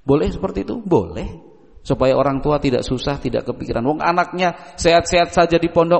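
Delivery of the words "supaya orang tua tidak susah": ordinary talk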